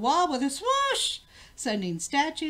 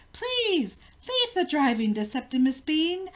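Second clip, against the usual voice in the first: high pleading call; urgent cry; 6 semitones higher, 4 dB louder than usual. A narrator's reading. Queen rushes up begging the king not to drive, urgent. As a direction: rush in and beg him to stop.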